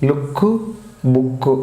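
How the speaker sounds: లుక్, బుక్ with the same short oo; short vowels. Shown as a demonstration. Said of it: "short ప్రొనౌన్సియేషనే". (లుక్, బుక్ లో పొట్టి ఊ శబ్దం)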